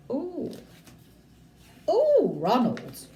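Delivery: slow drawn-out tut; disapproving ooh. Acted. Teacher reacting to rude Ronald, slow disapproving ooh. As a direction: show the class your disgust. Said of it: "teacher reacts to Ronald".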